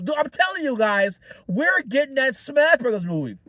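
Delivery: usual speaking voice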